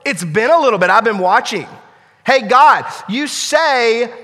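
loud, high, driving sermon delivery; preacher calling out to the church with fire; preaching at full force. Acted. Preacher warns congregation, loud and high.

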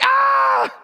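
high, loud scream of fright; startled scream. Acted. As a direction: let out a piercing mock-terror shriek. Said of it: "She's about to scream".

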